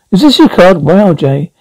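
normal talking voice

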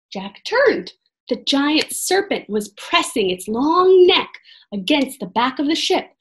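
ordinary delivery

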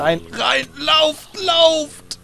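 high panicked shouting; being chased. Real, not acted. Fleeing monsters, urging friends.

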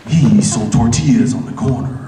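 very low, dramatic promo narrator; mock-epic trailer narration; movie trailer voice. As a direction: intone it deep, with portent. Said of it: "anything with that voice".

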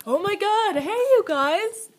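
high, loud, gushing vlogger greeting; mocking her own over-the-top hello; mock bubbly self. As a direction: overdo your own excited intro. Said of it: "Why am I so like".

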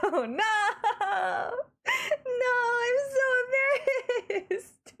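squealing through laughter, mortified; embarrassed laughing. Real, not acted. Streamer to chat, mortified by the copypasta, laughing and squealing.

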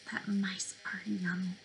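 whispered, snaky hiss; breathy snake whisper for the riddle. Acted.

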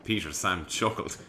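usual speaking voice

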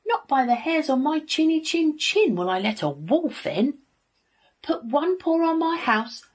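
high, loud defiant pig; rude shouting. A narrator's reading. Rude little pig refusing the wolf entry, shouted defiance. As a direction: bellow a refusal in a high pitch. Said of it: "shouted the rude little pig".

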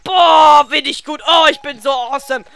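triumphant shout. Real, not acted. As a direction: crow about the hit. Bragging after one-hit kill. (lauter, prahlender Jubel)

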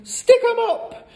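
very high, bold yell. A narrator's reading. Matilda confronts supposed burglars, yelling. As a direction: shout it like a play-acting hold-up. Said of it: "she yelled".

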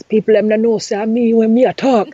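quick demo of Jamaican patois; easy, casual Jamaican accent. Shown as a demonstration. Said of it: "a Jamaican one's very easy".

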